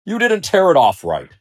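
loud, high-pitched fault-finding; critical lion. A narrator's reading. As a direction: criticize them sharply and haughtily. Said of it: "he would criticize".

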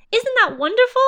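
high, loud delighted question; delighted exclamation. A narrator's reading. Christopher Robin praising Pooh's find, delighted high exclamation. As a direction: gush with delight.

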